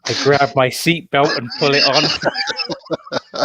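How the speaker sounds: telling it through real laughter; slow, laughing as you speak. Real, not acted. Storyteller recalling his panic at the lights, laughing.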